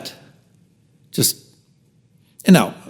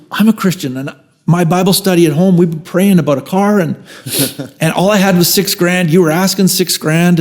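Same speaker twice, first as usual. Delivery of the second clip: excited buyer; 6 semitones higher than usual. Retold or imitated. Young buyer explaining his group's prayers, excited. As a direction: rush it out with joy.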